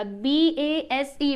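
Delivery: B, A, S, E spelled slowly, one letter at a time. Shown as a demonstration. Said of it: "बेस कहलाएगा".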